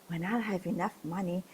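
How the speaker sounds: ordinary delivery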